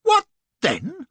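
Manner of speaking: high, loud outraged echo. A narrator's reading. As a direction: throw his words back at him. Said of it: "says I".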